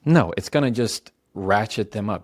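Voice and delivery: ordinary talk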